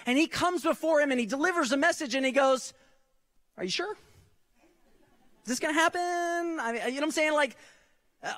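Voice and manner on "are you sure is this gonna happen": mock-doubtful, pitched up high; doubtful questioning. Preacher imitating Zechariah questioning the angel, mock doubt. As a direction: play Zechariah as a skeptic, half-joking.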